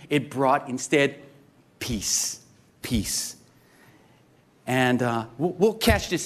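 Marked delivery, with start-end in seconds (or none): 1.8-2.4 hushed peace. Acted. 2.8-3.4 whispered peace. Acted. Preaching on peace, hushed.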